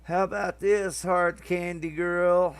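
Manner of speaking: high, loud shout to the crowd; calling out. Real, not acted. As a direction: hype the audience up with a big call.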